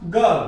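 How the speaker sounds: गर्ल said with a silent r; correct pronunciation. Shown as a demonstration. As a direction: say गर्ल as "gal", no r sound. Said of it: "उच्चारण में आपने गलतियां की हैं".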